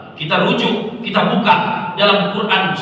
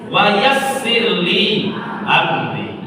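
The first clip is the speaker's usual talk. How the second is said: slow recitation; much slower than usual. Acted. Recites Musa's prayer.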